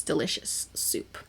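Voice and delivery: hushed, slow and dreamy; whispered delight. Real, not acted. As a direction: breathe the words as if tasting them. Savoring the Greek soup.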